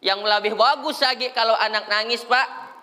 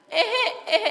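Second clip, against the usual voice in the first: put-on high fussing kid voice; child fussing; 14 semitones higher than usual. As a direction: whimper like a toddler.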